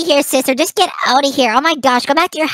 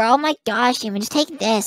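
ordinary talk

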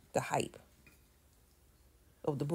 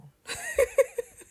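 high-pitched burst of laughter; 5 dB louder than usual. Real, not acted. Speaker capping "we hold the crown", high laugh. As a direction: break into a high, ringing laugh. Laughs after own boast.

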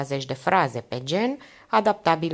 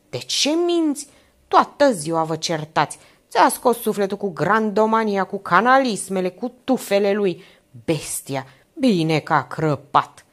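heated, louder tirade; angry outburst; 4 semitones higher, 5 dB louder than usual. Acted. Old man storms in on Anca, angrily ranting. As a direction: rant with rising force, slowing hard on the last words.

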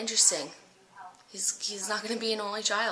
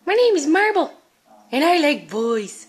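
dog's voice; 7 semitones higher, 12 dB louder than usual. Pretend. Voicing the dog.

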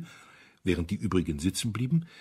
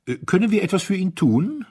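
much higher, louder, fatuous; gushing idle courtesy; silly politeness; 12 semitones higher, 8 dB louder than usual. A narrator's reading. Rich duke offering help with silly politeness. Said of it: "blödsinnigen Liebenswürdigkeit der Reichen".

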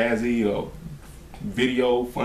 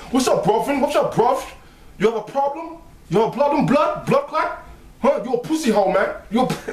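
mock British urban slang voice; London urban accent; 10 semitones higher than usual. Imitating how they talk in British urban films, London accent. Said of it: "got that, like, Jamaican kinda African accent, but they British".

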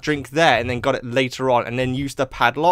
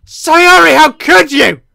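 loud, high anguished mock shout; dramatic outcry; 11 semitones higher, 11 dB louder, slower than usual. Gamer cries out at Sayori, hammed-up dismay. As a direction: wail it in hammed-up betrayal.

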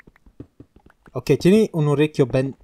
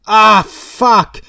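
high, loud curse of dismay; exasperated shout; 6 semitones higher, 8 dB louder than usual. Real, not acted. Player realises something was left behind, exasperated shout. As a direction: yell it as you realise your blunder.

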